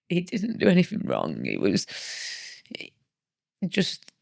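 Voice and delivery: real distress. Real, not acted. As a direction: defend him through rising upset. Recounts husband's shock at child's death.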